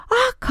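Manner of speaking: short high-pitched yelp; sudden scream. A narrator's reading. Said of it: "비명을 지르는 거예요". (갑자기 지르는 짧은 비명)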